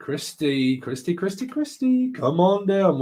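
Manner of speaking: repeated name climbing high, then the call-up; playful game-show summons, pitch rising; game-show host. Pretend. Host calls the winning moderator down, game-show style.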